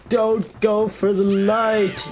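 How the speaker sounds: hammed-up slow warning cry; cartoon warning. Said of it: "Unlike most of the cartoons, which is like".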